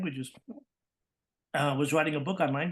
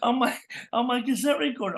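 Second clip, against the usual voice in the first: high-pitched, louder mock outrage with a laugh; hammed-up indignant dad catching his kid; exasperated dad; 10 semitones higher, 4 dB louder than usual. Dad finding his recorder taken, mock-indignant outburst. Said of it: "my dad would go".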